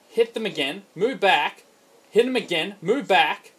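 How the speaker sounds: ordinary talk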